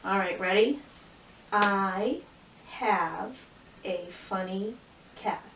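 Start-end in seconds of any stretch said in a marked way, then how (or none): 1.5-5.4 word by word. Shown as a demonstration. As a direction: pause after each word, like a beginning reader. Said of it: "Let's go back and read that".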